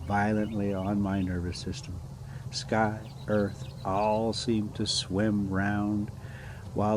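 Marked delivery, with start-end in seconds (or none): none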